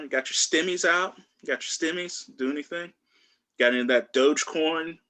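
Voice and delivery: ordinary talk, nothing marked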